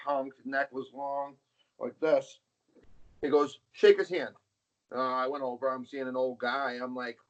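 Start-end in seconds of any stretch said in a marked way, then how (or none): 3.7-4.3 father's command. Retold or imitated. Father ordering son.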